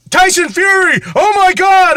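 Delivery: high loud fake hype; overexcited promo call; hype announcer. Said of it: "when they first".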